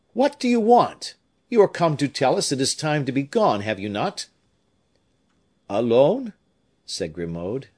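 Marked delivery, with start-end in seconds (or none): 0.1-4.3 high, loud questioning that settles at the end; crying out. A narrator's reading. Raoul questioning the servant who followed them, crying out. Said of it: "cried Raoul". 5.7-6.3 high, loud rising question; reproachful. A narrator's reading. Troubled old servant questioning his master, reproachful. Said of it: "in a tone of reproach".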